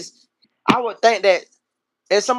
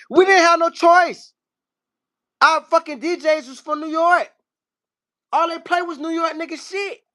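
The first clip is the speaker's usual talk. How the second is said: high-pitched indignant defense; exasperated protest; 11 semitones higher than usual. Real, not acted. Defending himself over New York influence, high exasperated protest. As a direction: plead your case, pitch pushed way up.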